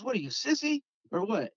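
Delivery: high-pitched goading jab; retold taunt. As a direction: imitate a buddy daring him. Buddy taunting him, retold.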